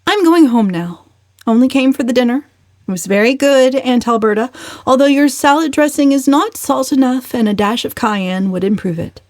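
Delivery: high, bright-edged cheek; cheeky parting shot. A narrator's reading. Valancy leaving the dinner, cheeky parting remark. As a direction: sign off with mock courtesy.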